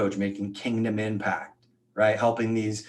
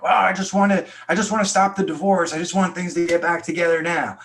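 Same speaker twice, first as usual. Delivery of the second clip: high, loud pleading client voice; imitating a desperate client; 9 semitones higher, 6 dB louder, faster than usual. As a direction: plead like a client desperate to save her marriage. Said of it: "It's like".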